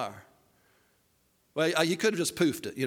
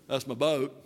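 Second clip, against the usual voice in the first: lower, deadpan film-character voice; Forrest Gump; 4 semitones lower than usual. Acted. Boat crashing in behind him, understated Gump impression. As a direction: say it in character, pitched down. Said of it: "That's my Forrest Gump".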